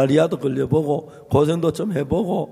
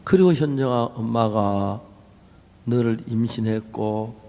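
quietly; 6 semitones lower than usual. Retold or imitated. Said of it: "사모님이 조용히 말씀을 해요".

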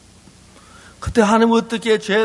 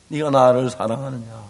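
Jesus asking; 8 semitones lower than usual. Acted. Jesus voiced in Peter retelling.

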